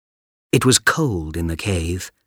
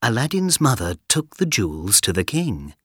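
ordinary delivery, nothing marked

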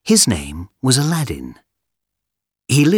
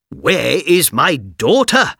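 angry king; 7 semitones higher, 3 dB louder, slower than usual. Acted.

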